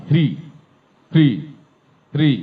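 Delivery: হৃ sounded as h-r-i, clipped and repeated; drilled syllable. Shown as a demonstration. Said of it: "এইচ আর আই". (হৃ বারবার, এইচ আর আই)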